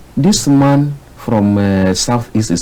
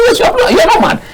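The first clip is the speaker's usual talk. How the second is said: a hint of his wife, pitched much higher; imitating wife; 5 dB louder than usual. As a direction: lightly voice the reassuring wife, up high. Voices his wife reassuring him.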